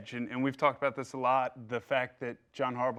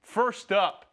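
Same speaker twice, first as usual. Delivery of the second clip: upbeat, louder host read, pitched up; show host intro; 4 semitones higher, 4 dB louder than usual. Acted. Host kicks off the mailbag segment, bright announcer read. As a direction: welcome viewers back like a TV host.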